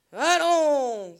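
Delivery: lance-le fort, en exhortant, comme un ordre de berger; appel clamé. Acted. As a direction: crie l'ordre au taureau, voix portée. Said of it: "clama François". (François clame au taureau, appel fort et autoritaire)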